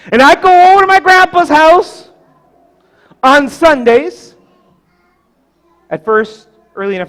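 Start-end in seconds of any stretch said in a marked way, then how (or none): 0.1-4.4 high, loud, emphatic pulpit delivery; preach. Acted. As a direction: proclaim each phrase, with big pauses between. Preacher storytelling at full force.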